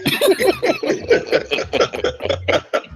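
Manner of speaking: voiceless, high-pitched wheezing laugh; breathless high laugh. Real, not acted. Laughing at the made-up name.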